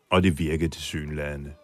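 normal talking voice

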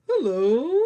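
squeaky little puppet greeting; ladybug voice. Pretend. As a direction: pipe up like a tiny bug.

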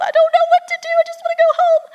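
very high, loud squealed mock panic; hammed-up terrified baby bird squeal; freaking out. Baby bird lifted up by the snort, squealing in acted panic. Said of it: "he's freaking out".

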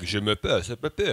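mock French gibberish, lightly done; fake French. Shown as a demonstration. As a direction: put on a fake French accent. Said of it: "a fake French thing but can't speak French".

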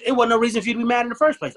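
exasperated emphasis on no reason to be mad; emphatic, rushed. Real, not acted. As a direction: speed up and lift it as it lands. Emphatic point.

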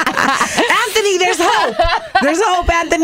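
high laughing call to Anthony; laughing tease. Real, not acted. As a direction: tease him through laughter.

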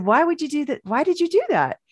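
imitating a baffled wife asking why; confused disbelief. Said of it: "And she was confused".

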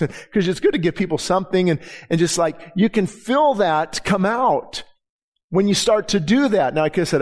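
ordinary talk, nothing marked

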